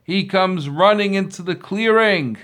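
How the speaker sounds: ordinary delivery, nothing marked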